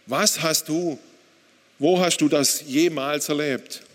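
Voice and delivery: forceful, heated protest in bursts; angry challenge to God. Retold or imitated. Husband telling God he cannot understand, angry challenge. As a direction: accuse God, driving the stressed words hard. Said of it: "Ich antwortete laut und wütend".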